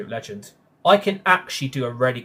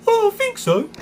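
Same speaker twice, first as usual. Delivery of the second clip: very high, loud cartoon crane voice; Lofty impression; 8 dB louder than usual. Streamer repeating his Lofty voice for chat, high and squeaky. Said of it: "There's my Lofty impression".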